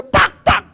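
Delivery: loud high "pak, pak" slaps; loud mock slapping noises; imitated double slap. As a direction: clap the sounds out sharply. Slapping mosquitoes sound.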